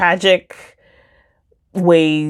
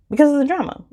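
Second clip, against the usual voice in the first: "Because" thrown up much higher, then falling; playful emphasis; 9 semitones higher, faster than usual. Shown as a demonstration. Reviewer repeating her reason for listeners, playfully emphatic. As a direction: relish the drama in it.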